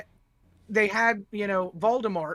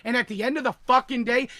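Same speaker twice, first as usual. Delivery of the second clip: normal talking voice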